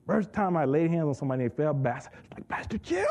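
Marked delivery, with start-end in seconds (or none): 2.5-3.0 excited call. Retold or imitated. Calling pastor after miracle.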